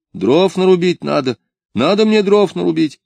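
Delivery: high, insistent protest; defiant answering back. A narrator's reading. Missouri answering her scolding mistress, defiant protest.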